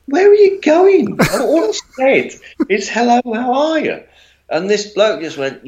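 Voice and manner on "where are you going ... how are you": high-pitched, innocent plea; retold bewildered, polite objection; polite protest. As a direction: protest politely in a much higher voice. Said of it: "and really polite about it, not aggressive in any way".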